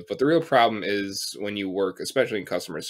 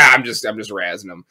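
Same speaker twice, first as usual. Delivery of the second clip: loud, breezy dismissal; louder retold customer shrugging it off; brushing it off; 10 dB louder than usual. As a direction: wave it away loudly as a joke. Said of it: "he's like".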